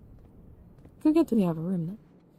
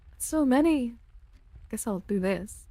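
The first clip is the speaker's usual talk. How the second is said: ordinary delivery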